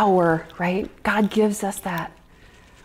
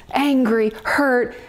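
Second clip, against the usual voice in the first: usual speaking voice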